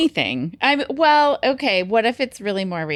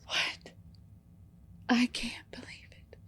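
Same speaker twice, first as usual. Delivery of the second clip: whisper yelling; 5 semitones higher, 10 dB quieter than usual. Pretend. Mock soap drama, gasped.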